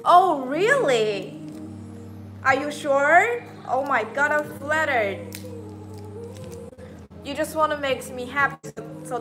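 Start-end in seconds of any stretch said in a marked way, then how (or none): none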